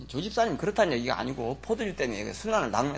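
ordinary delivery

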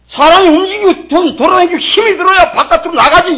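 high, loud pulpit shout; real scolding. Real, not acted. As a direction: thunder reproachful questions at the congregation. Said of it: "그래서 지금 호통치는 거예요".

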